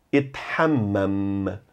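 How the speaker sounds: Ethammam slow and soft, final M held long; stress final M. Shown as a demonstration. Teacher repeating Ethammam, holding the final M. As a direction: lean on the last M of Ethammam. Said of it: "Valorize o som dos M's, especialmente o do final".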